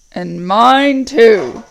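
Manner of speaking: very slow, loud orc captain; deep voice. Acted. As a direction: back up the order, heavy and slow. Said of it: "said the deep voice".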